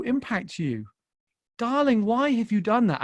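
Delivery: high, insistent fatherly pleading; inner plea. Retold or imitated. Father silently asking his daughter why she let it hurt, pleading. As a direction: plead in disbelief, leaning on each phrase. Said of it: "I found myself in this internal plea".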